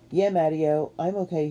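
plain reading voice

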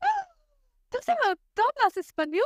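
bright high gasped question; excited high surprise. Retold or imitated. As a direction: squeal it as an eager student. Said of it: "look at me like".

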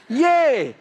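"Yea" flung out high and loud like a cheer; mock cheery yea. Shown as a demonstration. The preacher mocking the King James yea as a loud cheer. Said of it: "Nobody says yea ... unless there's something broken in you".

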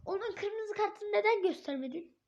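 usual speaking voice

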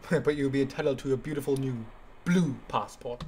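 lightly mocked British politician; Boris Johnson. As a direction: give a hint of a pompous statesman's quote. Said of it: "that's my favorite Boris quote".

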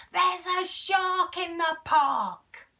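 slow, high, loud shouted warning; yelling. Acted. Boy yells to everyone that a shark is there. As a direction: yell it with all your might. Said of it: "Timothy yells with all his might".

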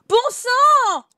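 loud, high exasperated cry; real fed-up yell; frustrated shout. Real, not acted. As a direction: burst out cursing the game. Frustrated player cursing the game.

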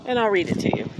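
ordinary talk, nothing marked

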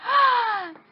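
loud, high shocked gasp; exaggerated sharp gasp; frightened gasp. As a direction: gasp dramatically in mock fright. Acted fright as ghost reappears.

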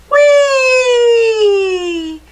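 very high, loud, long squeal; sliding whee. A narrator's reading. Mouse slid down a steep bank, long high loud whee. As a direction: hold a high whee that slides slightly down.

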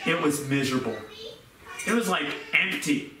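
usual speaking voice

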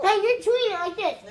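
normal talking voice

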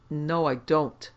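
loud, drawn-out firm refusal; vehement snap. A narrator's reading. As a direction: refuse vehemently, leaning on the words. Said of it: "snapped Sedgwick vehemently".

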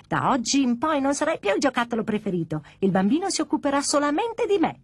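put-on high-pitched bratty baby doll, climbing to a wail; taunting baby voice. New doll tells Pino he is replaced, taunting baby. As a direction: rub it in like a spoilt baby.

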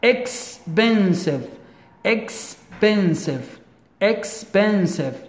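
expensive drawn out slowly, each syllable separate; careful syllables. Shown as a demonstration. Teacher modelling expensive for students, slow and syllabic. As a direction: stretch expensive slowly, ex-pen-sive. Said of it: "We have here three syllables".

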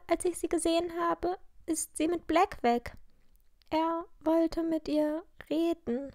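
high voice, troubled telling; worried girl character. A narrator's reading. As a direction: voice a schoolgirl reporting her missing friend. Narrator voices Lilly in dialogue.

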